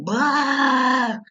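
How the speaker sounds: a quick hammed-up crying howl; mock bawling. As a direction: burst out in a fake wail. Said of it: "And he starts bawling".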